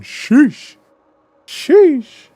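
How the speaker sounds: squeaky drawn-out sheesh; high-pitched sheesh. Pretend. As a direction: shriek sheesh high and loud. Exaggerated exclamation, very high.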